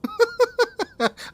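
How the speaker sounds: bright high burst of laughter. Real, not acted. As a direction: laugh it off in disbelief. Laughing at the timing.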